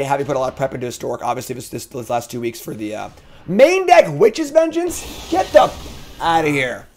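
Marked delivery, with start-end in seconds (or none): 3.5-5.3 high, slow disbelieving squeal; outraged disbelief; incredulous outrage. Real, not acted. Streamer outraged at opponent's card. 5.3-6.9 playful fake anger; mock outrage. Real, not acted. Streamer outraged at opponent's card.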